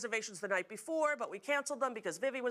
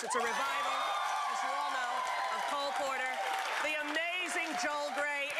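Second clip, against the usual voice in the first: higher, bright intro of the stars; show announcer hype; 4 semitones higher than usual. Acted. Host announcing the musical's cast to the audience, hyped. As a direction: sell the guests to the crowd.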